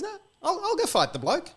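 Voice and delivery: high, keen offer to fight; eager volunteer. Retold or imitated. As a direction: jump at the chance.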